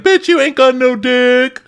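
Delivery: high, loud, drawn-out jeer; mock taunt. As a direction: imitate a castmate taunting, stretched and high. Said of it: "taunting her now".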